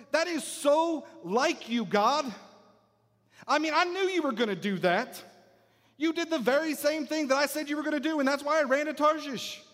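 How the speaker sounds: high-pitched, exasperated accusation; angry Jonah. Acted. Jonah complaining to God about sparing Nineveh, angry. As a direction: throw it at God as an aggrieved told-you-so. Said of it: "He's angry".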